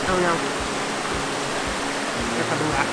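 normal talking voice

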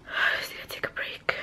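tired whispered admission. Real, not acted. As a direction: breathe it out in a whisper. Confiding about parents.

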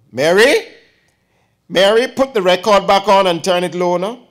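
loud, high call across the house. Acted. Aunt calling the maid to change the record, loud call. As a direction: call to the maid in another room.

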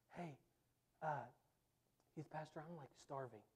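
put-on hungry kid whispering to youth pastor mid-service; hushed plea. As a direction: murmur so the preacher can't hear.

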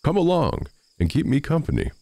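high, lightly called invitation; calling out. A narrator's reading. Miller calls the little farmer to join him, crying out. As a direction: call over at ordinary volume, pitch lifted. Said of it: "he cried".

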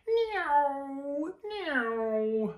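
high, squeaky bear-cub play sound; childish play noise. Acted. Baby Bear playing in bed, high squeaky noise. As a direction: squeal like a little one playing.

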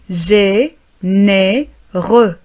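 "Généreux" split slowly as gé, né, reux; very slow "Généreux", pause between syllables. Shown as a demonstration. Teacher modelling for learners, syllable by syllable "Généreux".

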